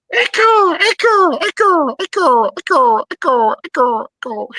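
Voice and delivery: high voice fading like an echo; canyon echo. Pretend. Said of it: "he'd be doing this".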